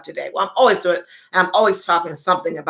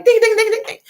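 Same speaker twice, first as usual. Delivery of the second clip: high ding-ding bell; 4 dB louder, faster than usual. Acted. Correct-answer bell imitation.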